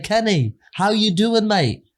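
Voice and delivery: put-on high-pitched, chummy English accent; put-on English accent, pitched well up. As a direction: imitate an English car salesman greeting a mate. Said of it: "the guy's got an English accent".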